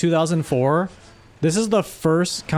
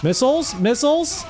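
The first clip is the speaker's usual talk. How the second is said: shouting orders over battle; 10 semitones higher than usual. Real, not acted. Troops under fire.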